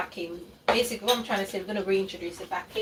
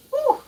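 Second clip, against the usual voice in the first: sharp high blowing breath; high loud exhale; 4 dB louder than usual. Real, not acted. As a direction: blow out the sting. Still burning throat.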